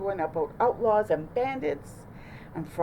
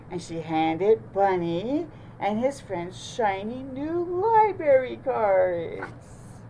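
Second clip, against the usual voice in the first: usual speaking voice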